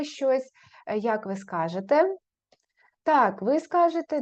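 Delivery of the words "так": ordinary talk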